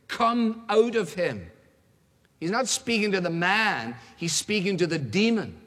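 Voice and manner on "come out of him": slow, raised, emphatic order; stern command. Acted. Jesus ordering the demon out of the man, stern and slow. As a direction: command it word by word, firmly. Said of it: "said Jesus sternly".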